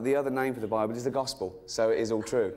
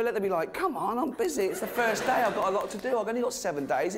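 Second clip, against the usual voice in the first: impatient God; 7 semitones higher than usual. Pretend. God voiced as busy and impatient.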